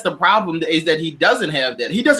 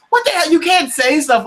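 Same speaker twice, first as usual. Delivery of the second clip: indignant; 8 semitones higher, 4 dB louder than usual. Real, not acted. Outrage at Trump's loyalty demand.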